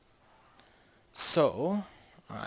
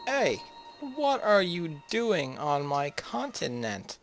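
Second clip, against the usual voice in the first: mock indignation; 5 semitones higher, slower than usual. Scolding rival Portugal.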